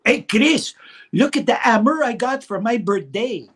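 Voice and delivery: high, loud child imitating a thick Jean Chrétien French Canadian accent. Boy brags to cousin Chris in a Jean Chrétien accent. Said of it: "a great Jean Chrétien accent".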